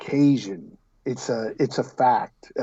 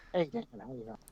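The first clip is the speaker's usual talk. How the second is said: higher, very hushed spooky rock-star greeting; 5 semitones higher, 13 dB quieter, faster than usual. Retold or imitated. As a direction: breathe it out like a creepy rock star. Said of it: "people think he talks like this".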